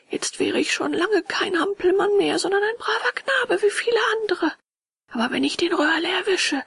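high, tearful wail turning indignant; lamenting puppet. Acted. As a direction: bewail your foolishness in a high voice. Puppet laments, then threatens Röhrle. (jammernder Hampelmann mit hoher Stimme)

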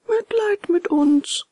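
high, slow, drawn-out lament; long high whine; wailing echo. A narrator's reading. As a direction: echo the plea slowly and piteously. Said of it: "So heulten die zwei Räuber".

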